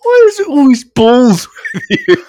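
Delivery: laughing at the creep's balls line; laughing exasperation. Acted. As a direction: crack up while complaining.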